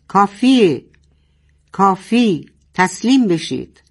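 roaring. Acted. Sheran's loudspeaker ordering surrender, loud roar. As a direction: bark the order to give up. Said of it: "میکروفون غرید".

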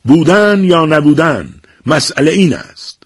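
slow, high, grand stage delivery; hammed-up Shakespeare recital; theatrical declaiming. A narrator's reading. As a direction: declaim Hamlet from the wall. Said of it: "با شور و هیجانی نمایشی".

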